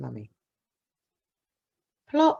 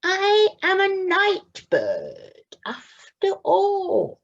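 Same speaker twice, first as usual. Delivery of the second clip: high, very slow, dawning pride; proud little owl; 4 dB louder, much slower than usual. Acted. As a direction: savour the discovery. Plop realises he's night bird.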